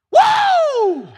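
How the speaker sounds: very high, loud, drawn-out whoop; marveling. Shown as a demonstration. Preacher demonstrating what marveled means, a marveling whoop. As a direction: whoop in wide-open amazement. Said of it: "You know what it means?".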